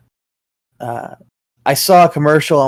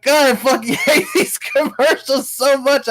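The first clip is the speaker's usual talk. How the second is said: laughing rant; 9 semitones higher than usual. Real, not acted. Ranting about hated commercials.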